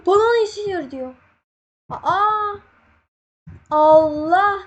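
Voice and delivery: gasp, then high, loud cries of shock; startled shout. Real, not acted. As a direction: jump out of your skin. Sudden shock in game.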